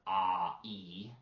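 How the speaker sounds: "ah" and "e" as two separate vowels; separated vowels. Shown as a demonstration. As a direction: pull the diphthong apart, "ah", then "e". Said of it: "which is two vowels together".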